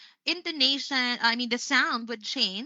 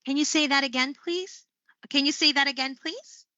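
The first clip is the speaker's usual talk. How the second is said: "Can you say that again, please" pitched up, climbing high on "please"; rising intonation; 4 semitones higher than usual. Shown as a demonstration. Trainer as call center agent asking for a repeat, rising intonation. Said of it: "if they want people to repeat it".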